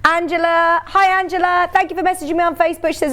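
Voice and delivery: usual speaking voice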